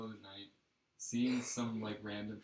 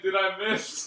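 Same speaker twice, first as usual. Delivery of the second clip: loud, higher-pitched joking impersonation; mock quote; 8 semitones higher, 14 dB louder, slower than usual. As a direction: blurt it out as the clueless drunk. Mock quote of sleepy pisser.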